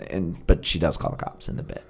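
ordinary delivery

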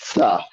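"sa" opened with a crisp ts; TS sound. Shown as a demonstration. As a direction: say "sa" as tsa, t and s joined. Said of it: "T Z or T S".

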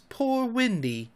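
slow, high plaintive moan; soft, slow lament. A narrator's reading. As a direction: moan it mournfully, drawn out. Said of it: "it moans".

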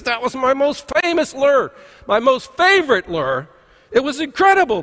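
high, worked-up lament; mock dismay. Fisherman bemoans his lost favorite lure, mock dismay. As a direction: imitate an upset angler, pitch leaping up.

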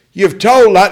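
high, loud shout from the crowd; heckler's shout. Retold or imitated. Heckler calling out to the comic on stage, loud and high. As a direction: yell it up at the stage. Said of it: "someone shouted".

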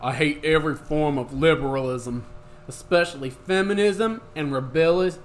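loud swaggering dude voice; frat bro. Acted. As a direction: read it like a cocky bro. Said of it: "gonna get in my, uh, bro voice".

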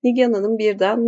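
plain reading voice

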